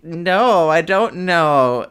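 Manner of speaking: feigned ignorance. Retold or imitated. Patient playing innocent to the nurse, feigned ignorance. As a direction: play dumb with a slow, emphatic denial. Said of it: "I just pretended not to know".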